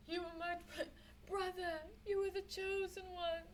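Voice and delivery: mock crying. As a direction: fake tearful farewell. Mourning a fallen brother, mock.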